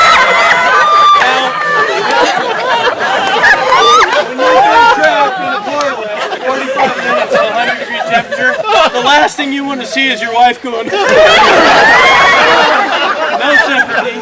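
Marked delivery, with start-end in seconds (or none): 0.1-8.5 comic outrage, voice cracking high; indignant high shout. Acted. Comedian rants to audience about his wife laughing. 10.9-13.3 loud, high mocking laugh; imitated wife's cackle. Wife laughing at trapped husband, high cackle.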